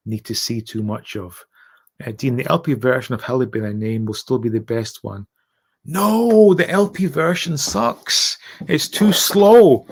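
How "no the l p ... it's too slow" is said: loud emphatic. Real, not acted. Emphatic disagreement about LP version.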